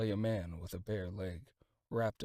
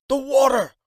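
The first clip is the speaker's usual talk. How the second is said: 1 urgent demand; 19 dB louder than usual. A narrator's reading. Servant insists urgently.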